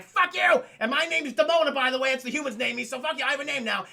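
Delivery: loud, high-pitched mock rage; furious defiance. Demona announcing her name to Goliath, furious. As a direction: spit back defiantly in acted fury.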